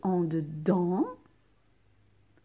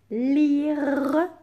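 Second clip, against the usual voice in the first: loud dictation; 6 semitones higher, 6 dB louder than usual. Shown as a demonstration. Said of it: "teacher dictates next word".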